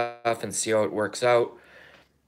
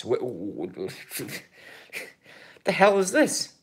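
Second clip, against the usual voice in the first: laughing disbelief; slower than usual. Real, not acted. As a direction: splutter then laugh it out.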